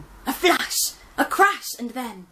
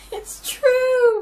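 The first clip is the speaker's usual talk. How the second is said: high, loud, crying admission; wailing; 11 dB louder than usual. Acted. Gran admitting she's trapped, wailing. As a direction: sob it up high. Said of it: "wailed Gran".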